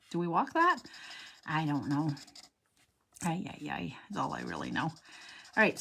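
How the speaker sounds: usual speaking voice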